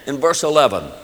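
ordinary delivery

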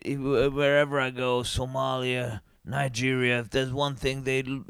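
lightly voiced earnest rock star, soft; a hint of a self-important singer; hushed fake-sincere stage talk; mock singer. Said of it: "he said".

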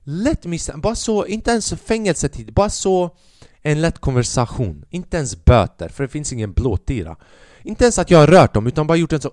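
ordinary talk, nothing marked

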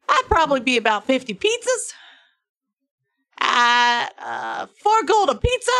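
put-on high-pitched, loud accented tavern keeper; bad-accent barkeep. Barkeep offering breakfast and lunch pizzas, hammed-up accent. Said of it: "only do one version of this accent".

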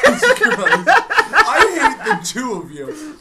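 slow laughing high complaint. Real, not acted. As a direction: laugh it out slowly. Laughing at friends' mess.

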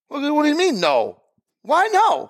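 mock-incredulous, high pitched protest. Retelling the barbecue guy's refusal, incredulous high squeak. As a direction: act baffled by the answer.

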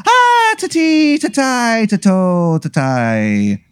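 high, drawn-out stage-Irish lilt; terrible Irish accent. Acted. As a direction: sing out nonsense in a hammy Irish brogue. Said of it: "the worst Irish accent".

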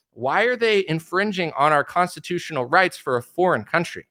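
ordinary talk

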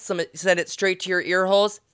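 normal talking voice

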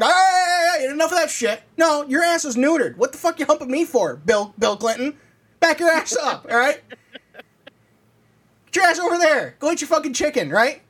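scolding the dog off your back; yelling at the dog. Pretend. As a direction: shoo the dog off, half-laughing.

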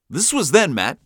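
ordinary delivery

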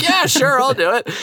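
high, tipsy-eager mock enthusiasm; retold eager agreement.

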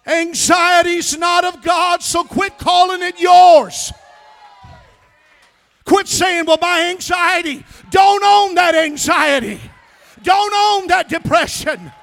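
loud, high preacher's rally cry; full-force preaching. Acted. Preacher urging the church to disown anxiety, shouting. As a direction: hammer it home to the congregation.

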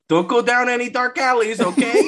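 high, loud, awkward mock caller; Peter. Peter calls Mary Jane on a payphone, high mock-earnest voice. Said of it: "He's like".

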